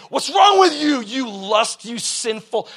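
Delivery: loud mock accusation; harsh accusing prophet. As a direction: bark it at him like a judging prophet. Mocking harsh prophet confronting someone.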